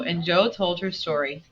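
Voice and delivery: ordinary delivery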